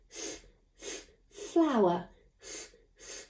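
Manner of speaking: "F, f" as a voiceless hissed f; segmenting. Shown as a demonstration. Teacher sounding out the f in flower. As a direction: push air through the "f" without a vowel. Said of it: "What does flower begin with?".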